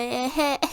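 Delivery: high mock giggle; imitated laugh. Vlogger mimicking how Sophie laughs, put-on cackle. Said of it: "elle rigole comme ça".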